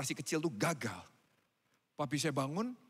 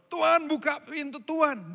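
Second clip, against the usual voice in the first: higher, louder desperate calling; pleading shout; 9 semitones higher, 9 dB louder than usual. Acted. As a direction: beg loudly at a locked door. Pounding on the shut door. (berseru memohon dengan keras)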